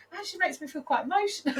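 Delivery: laughing through tears; fast, laughing emotionally. Real, not acted. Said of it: "I'm getting emotional".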